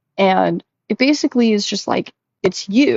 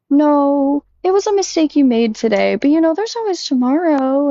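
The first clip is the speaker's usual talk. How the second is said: higher, sugary chatbot reassurance; mock soothing chatbot; 6 semitones higher than usual. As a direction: play the too-kind AI comforting her. AI chatbot voiced consoling.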